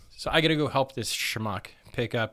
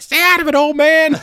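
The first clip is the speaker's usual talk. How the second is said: mock shouting; 14 dB louder than usual. [name] yelling back at father.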